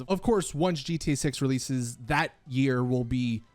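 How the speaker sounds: ordinary talk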